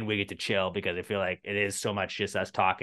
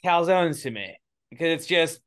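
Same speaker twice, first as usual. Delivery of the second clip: loud insistent; 8 semitones higher, 6 dB louder than usual. Real, not acted. Loud heated argument.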